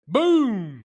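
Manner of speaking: high, loud mock gunshot; gunshot boom. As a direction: bark out a gunshot sound. Retelling the gunshot.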